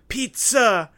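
hammed-up Italian accent rising high. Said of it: "I was gonna play an Italiano".

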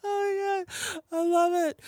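high, teary laughing voice; helpless laughter. Real, not acted. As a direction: squeeze the words out through laughter. Helpless laughter at funny scene.

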